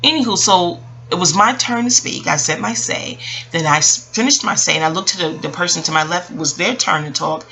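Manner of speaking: normal talking voice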